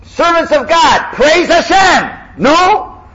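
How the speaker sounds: high, loud, drawn-out shouted praise with laughter; fiery preacher. Shown as a demonstration. Teacher plays the chazan calling out to the congregation. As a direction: preach it like a revival leader. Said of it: "Sounds like a Southern Baptist".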